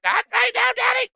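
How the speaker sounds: very high yappy pet voice; squeaky dog voice. Pretend. Owner voicing his Chihuahua answering, very high yap. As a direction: yip it like a tiny dog. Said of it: "she's like".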